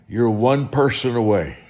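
normal talking voice

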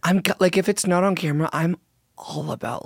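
ordinary talk

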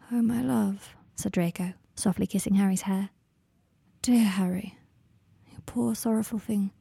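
soft, hushed and tender; tender whisper. Acted. Draco comforting crying Harry in the dark, tender whisper. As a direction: breathe it gently over him like a lullaby.

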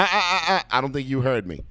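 ordinary delivery

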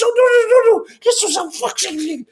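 panicked pleading. Retold or imitated. Pinned to wall, pleading.

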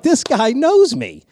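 high, slow wonder; starstruck. Retold or imitated. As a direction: marvel at being known. Retelling his own delight.

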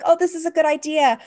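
high, loud, thrilled gasp of discovery; as if just struck by a great idea; delighted realization. Retold or imitated.

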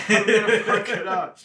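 high, loud laughing admission. Real, not acted. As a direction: laugh through the confession. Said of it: "I haven't been saying it".

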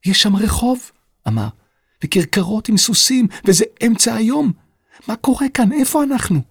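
put-on a boy staring out the window, stunned; astonished boy. A narrator's reading. Boy sees the 18th-century street. (ילד נדהם, הקול עולה)

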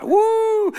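high, drawn-out "woo"; cheering whoop. Acted. Admirers fawning over you, high cheering whoop. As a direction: whoop like an adoring fan.

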